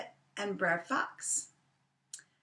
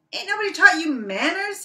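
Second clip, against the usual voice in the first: high, loud scolding rabbit voice; indignant Brer Rabbit; 11 semitones higher, 11 dB louder than usual. Acted. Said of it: "he said".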